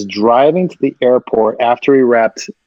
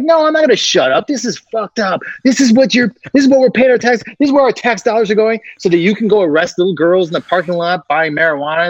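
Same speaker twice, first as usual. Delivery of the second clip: high, pitched-up indignant yelling; mock outrage; 8 semitones higher than usual. Actor staging a tantrum at the police, mock outrage. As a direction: rant like a furious taxpayer.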